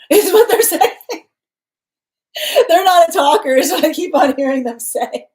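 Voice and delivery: high, loud laughing speech; real amusement, voice shooting up. Real, not acted. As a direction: crack up while reporting it. Cracking up at the message heard.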